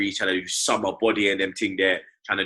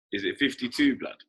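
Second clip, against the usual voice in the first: ordinary talk, nothing marked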